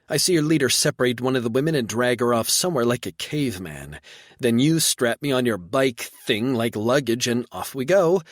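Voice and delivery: woman venting her fear and anger, loud; angry rant. Acted. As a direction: let it build to an outraged peak.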